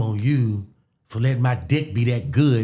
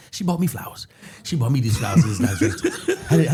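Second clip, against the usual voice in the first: ordinary talk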